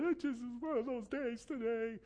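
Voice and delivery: a teary-happy squeal of a sigh; laughing self-quote. Retold or imitated. Retells own crying morning, laughing.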